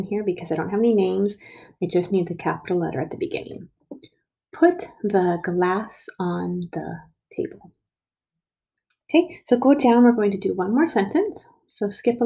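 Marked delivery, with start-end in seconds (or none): 4.5-7.7 slow, evenly spaced words; slow read-back. Shown as a demonstration. Teacher reading back the finished sentence, slowly.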